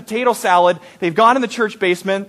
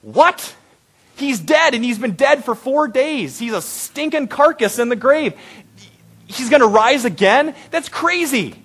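high-pitched, disbelieving outburst; acted incredulity; 6 semitones higher than usual. Preacher reacting to promise of brother rising, incredulous. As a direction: pitch jumping up in comic disbelief.

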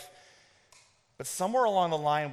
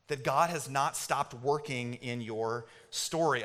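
usual speaking voice

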